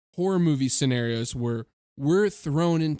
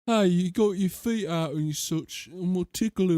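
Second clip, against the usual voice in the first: mock British slang accent; silly imitation British accent; 2 semitones higher than usual. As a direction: join in with a put-on British voice. Joins in the British accent riffing.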